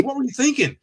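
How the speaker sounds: high-pitched exasperated question; mock scolding. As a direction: imitate an exasperated boss, pitch jumping up. Sergeant's scolding acted out.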